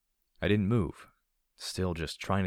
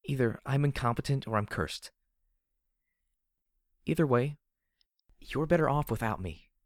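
despairing mutter; 6 semitones higher than usual. Acted. Heroine tells companion to leave her, despairing mutter. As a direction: give up on yourself in a raised pitch. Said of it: "I muttered".

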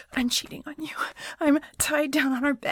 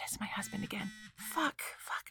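panicked whisper; 3 semitones lower, 13 dB quieter than usual. Acted. Hiding from husband, whispering.

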